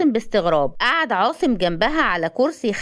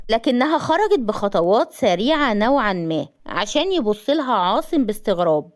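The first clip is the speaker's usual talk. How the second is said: ordinary delivery, nothing marked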